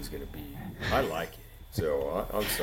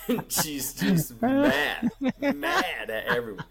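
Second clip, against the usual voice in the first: high, breathless laughing telling; can barely get the words out for laughing; 5 dB louder, slower than usual. Real, not acted. Telling a friend the funny choking story, laughing hard.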